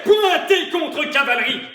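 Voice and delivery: shouted parade-ground order; military command. Acted. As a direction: bark it like an officer. (ordre militaire crié)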